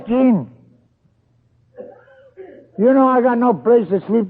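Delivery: loud, pleading and despairing; desperate outburst. Retold or imitated. Broke, homeless man pleads with his friend Gene, loud and despairing. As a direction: throw it at him in desperation.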